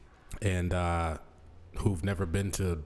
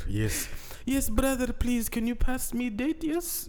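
high, slow put-on Arabic accent; mock a sister faking Arabic; fake Arabic accent; 14 semitones higher, slower than usual. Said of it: "Why are you talking like that".

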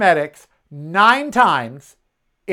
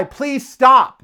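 raised, calling out to stop; retold yell; 7 semitones higher, 5 dB louder than usual. Yelling at a cyclist to stop, raised voice. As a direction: call out to the cyclist. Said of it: "I yell at the guy".

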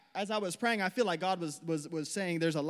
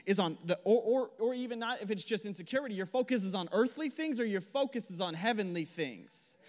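ordinary talk, nothing marked

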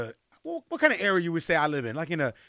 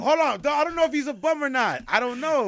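higher-pitched, insistent objection; defensive protest; 9 semitones higher, 5 dB louder than usual. Real, not acted. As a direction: push back hard, voice jumping up. Defends himself against friend's teasing.

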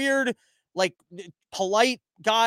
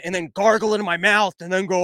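ordinary talk

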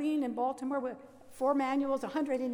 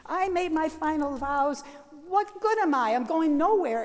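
higher, louder desperate plea; crying out to Jesus; 4 semitones higher, 7 dB louder than usual. Retold or imitated. Young nun crying out to Jesus about her vows, louder and desperate. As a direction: cry out in despair. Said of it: "I'm screaming to Jesus".

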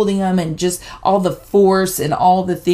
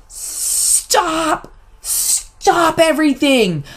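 frantic yell to stop; 10 semitones higher, much slower than usual. Retold or imitated. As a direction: cry out to halt everything at once. Mom yells to halt dentist.

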